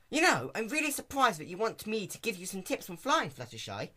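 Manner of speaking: high, brash tomboy pony; Rainbow Dash voice. Acted.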